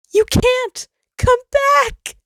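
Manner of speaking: high, loud desperate plea; desperate pleading. Acted. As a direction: beg them not to go. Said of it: "Sol pleaded".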